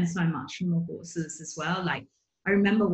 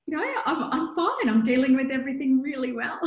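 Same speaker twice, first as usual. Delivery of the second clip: much higher, laughing through a mock claim of being fine; pitched-up laughing insistence that all is well; mock breezy denial; 8 semitones higher, 3 dB louder than usual. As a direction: chirp it up high like nothing's wrong, half laughing. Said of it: "I'm like".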